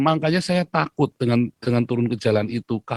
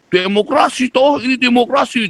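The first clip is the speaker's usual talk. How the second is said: mocking quote; 10 semitones higher than usual. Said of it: "dia bilang gitu".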